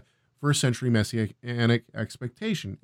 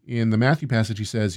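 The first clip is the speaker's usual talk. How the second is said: ordinary delivery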